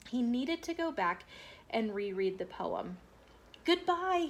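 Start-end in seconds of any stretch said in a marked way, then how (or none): 3.6-4.3 high hurried call; called goodbye. A narrator's reading. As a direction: shout goodbye rushing out. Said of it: "he called as he rushed out".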